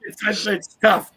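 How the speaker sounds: laughing through tears, slow; a wet, high laugh that breaks into words; real tearful laughter, pitched high; teary laugh. Real, not acted.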